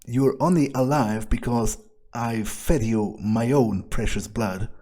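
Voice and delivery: ordinary delivery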